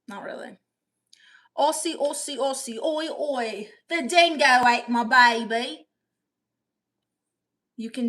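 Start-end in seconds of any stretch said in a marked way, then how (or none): 1.6-5.9 put-on Aussie chant building louder; broad mock-Australian, louder at the end; Australian accent. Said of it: "sound like an Australian".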